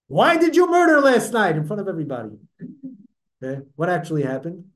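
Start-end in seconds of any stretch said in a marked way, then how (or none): 0.1-1.6 loud, high-pitched public accusation; acted angry yell. Prophet turns on a man in shul, yelling an accusation. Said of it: "yells at him".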